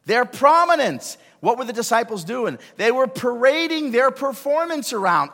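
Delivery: high, loud preacher's build; full-force preaching. Real, not acted. Pastor pressing the congregation, loud and high. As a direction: drive the point home to the congregation.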